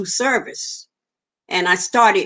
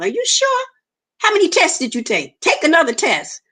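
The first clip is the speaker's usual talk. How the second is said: high, pleading questions in shock; panicked disbelief; 7 semitones higher than usual. Retold or imitated. Patient pleads with doctor for another test, high and panicked. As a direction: she begs the doctor to test again, pitch jumping up.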